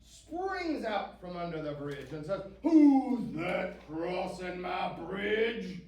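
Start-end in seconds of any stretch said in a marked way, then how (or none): 2.6-5.8 loud, slow troll challenge; big troll voice, drawn-out words; cranky troll. A narrator's reading. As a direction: bellow it slowly like a cranky troll. Troll challenges the middle goat.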